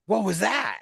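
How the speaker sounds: retold startled question, pitched high; high, mildly shocked retelling. Woman startled by flash, retold.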